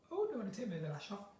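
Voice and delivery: usual speaking voice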